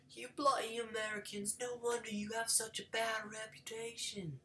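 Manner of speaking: high, squeaky bad British accent. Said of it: "That was my terrible British voice".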